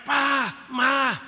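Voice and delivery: high, loud mock cry for parents; child calling out. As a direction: call out like a scared kid in the dark.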